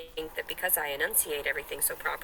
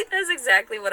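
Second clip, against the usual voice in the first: loud, high words through laughter; laughing; 10 dB louder than usual. Real, not acted. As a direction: keep cracking up while talking. Laughing at own story.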